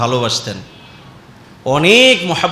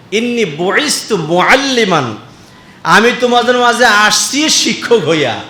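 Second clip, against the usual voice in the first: raised quotation; 5 semitones higher, 4 dB louder than usual. Acted. Quoting the Prophet, raised.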